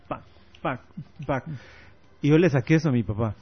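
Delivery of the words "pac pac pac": crisp rhythmic pac sounds; rhythmic clicks. Shown as a demonstration. Son showing his father's rhythmic ear, sharp clicks. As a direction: tap out a beat with the mouth. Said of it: "Mi papá es así".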